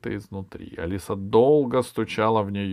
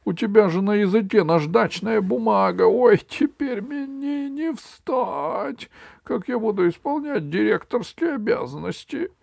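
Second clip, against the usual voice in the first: high, wailing complaint; milk the injury, drawing out the moan; lamenting king; 12 semitones higher, 5 dB louder than usual. Acted. Acted king laments his injury. (высокое жалобное причитание)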